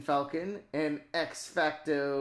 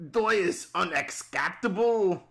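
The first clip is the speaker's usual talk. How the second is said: angry shout; 3 semitones higher, 6 dB louder than usual. Acted. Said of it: "let me repeat myself".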